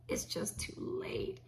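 slow whispered repeat of "It's just too late."; whispered echo. Shown as a demonstration. Host echoing her own line to viewers, slow whisper. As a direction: breathe the words again, no voice.

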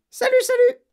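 loud, very high parrot squawks; squawking parrot. A narrator's reading. Parrot calling after Matilda, loud squawking.

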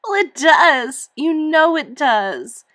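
high, loud, slow tearful protest; tearful insisting. Acted. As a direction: dig in, angry and crying. Upset girl insists, near tears.

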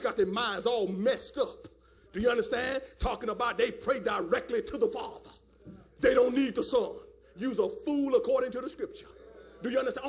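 ordinary talk

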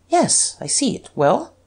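high, questioning reply; bewildered. A narrator's reading. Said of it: "looking at him in some bewilderment".

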